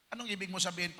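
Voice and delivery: normal talking voice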